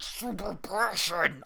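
super passion in a mangled put-on voice; mock super passion. Shown as a demonstration. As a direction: put on overblown passion, garbled. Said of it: "I don't have this".

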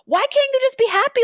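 high, strained disbelief; imitating her younger self's frustrated outburst; exasperated pleading. Retold scolding of depressed mother.

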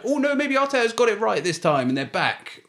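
high, mock-hopeful fan voice. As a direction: parody a fan who believes again. Mocking hopeful Arsenal fans.

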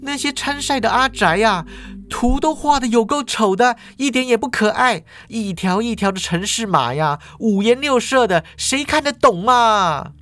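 put-on high-pitched bratty girl voice; girl complaining the game contest was dull; Ye Feng rolling eyes. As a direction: roll your eyes and whine high. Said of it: "叶枫就两眼一翻啊".

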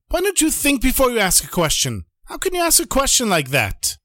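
high, exasperated scolding; shouting back. Acted. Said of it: "the Rebbe shouts back at him".

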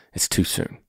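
a gentle whispered warning to Edwin; hushed. Real, not acted. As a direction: lean in and whisper it. Soft advice to listener.

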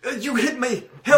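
high put-on voice, hurt and complaining; injured man's plea. Injured man to his rescuers, high pitiful plea. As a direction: plead in a high, wounded character voice. Injured Fujitsuki calling for help.

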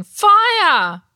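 high, loud drawn-out shout; alarmed cry. A narrator's reading. Everyone shouts as if warning of fire, crying out. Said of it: "as if crying".

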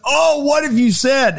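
high, shocked cry from the whole set; mock shocked gasp. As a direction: voice the crew gasping at the gaffe. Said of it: "the set immediately goes".

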